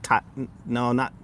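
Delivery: usual speaking voice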